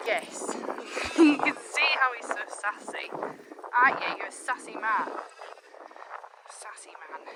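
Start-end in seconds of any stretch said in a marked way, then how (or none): none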